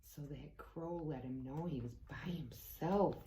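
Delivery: soft whispered aside. Real, not acted. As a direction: lean in and whisper. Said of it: "aside whispered".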